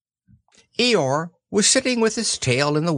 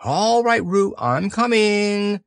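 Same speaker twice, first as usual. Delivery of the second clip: high, drawn-out reassuring call; 8 semitones higher, slower than usual. A narrator's reading. Christopher Robin hurrying to Roo, calling out. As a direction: call across the distance, high and long. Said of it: "called Christopher Robin".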